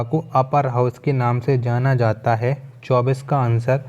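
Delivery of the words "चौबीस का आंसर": ordinary talk, nothing marked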